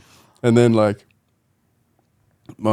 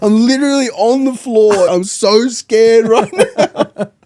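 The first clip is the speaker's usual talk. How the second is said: high, drawn-out mock whimper breaking into laughter; hammed-up frightened plea; scared pleading; 10 semitones higher, 5 dB louder, slower than usual. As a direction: wail it slowly, playing up the terror. Retold scared plea on the floor.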